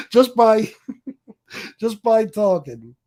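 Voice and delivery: breaking into high laughter mid-boast; laughing. Real, not acted. Host cracking up at their own threat, laughing. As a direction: laugh through the words, high and loud.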